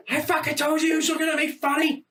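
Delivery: high, wailing mock accusation; hammed-up pained protest; anguished complaint. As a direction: cry it out from the cross. Acted man dying on the cross.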